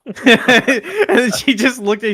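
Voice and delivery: laughing while talking; words breaking into laughter. Real, not acted. Telling funny store story.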